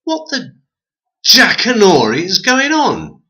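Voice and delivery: high, shocked exclamation; the man, stunned by talking objects, high and shocked; startled outburst. A narrator's reading. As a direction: blurt it out in disbelief.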